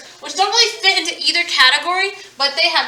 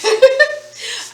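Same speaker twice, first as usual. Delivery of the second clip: laugh; 8 semitones higher, 4 dB louder than usual. Real, not acted. Laughing at own joke.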